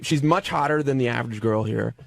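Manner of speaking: ordinary talk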